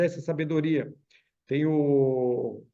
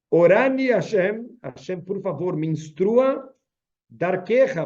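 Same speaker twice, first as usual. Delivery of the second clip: prayerful plea; 2 semitones higher, 4 dB louder, slower than usual. Shown as a demonstration. Prayer chanted to Hashem.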